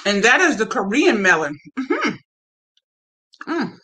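talking with food in mouth; speaking between bites; chewing while talking. Real, not acted. Seen 0: Bit melon at -5; still chewing while talking, holding piece.

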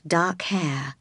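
ordinary delivery

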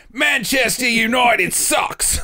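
slow, high shouted parody of bad Australian; mock Australian accent. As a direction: yell it in a botched Australian accent. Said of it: "Here's [name]'s Australian impersonation".

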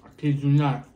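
ordinary talk

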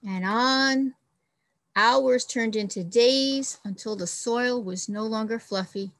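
expressive storybook read-aloud; reading to children. Acted. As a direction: read it like a picture book for the class. Teacher reads picture book aloud.

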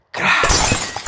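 breathy crash sound effect; imitated glass crash. Kid's ball smashes the glass door, voiced crash noise. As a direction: mimic the glass door shattering.

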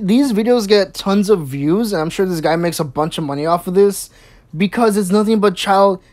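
ordinary delivery

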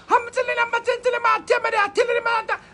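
high, driving, repetitive mock tongues; hammed-up Pentecostal gibberish, high-pitched; fake tongues. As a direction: babble ecstatically as if seized in church. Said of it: "me a talk in a tongues".

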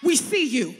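shouted callout. Acted. Preacher to her congregation, a shouted callout. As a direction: shout it out, stress on "We".

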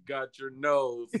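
slow mocking pompous echo; drawn-out lordly imitation. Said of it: "Why you sound so pompous".

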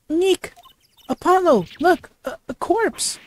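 very high, startled calling out; shriek-like alarm at a grisly find; high-pitched panicked character voice; alarmed cry. Acted. Character discovers a corpse.

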